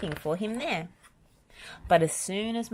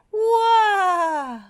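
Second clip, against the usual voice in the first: high, loud yelp of surprise; a sudden yelp while tripping; startled cry; 9 dB louder than usual. A narrator's reading. Spud in the costume yelps as he trips, startled. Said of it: "cried the dragon".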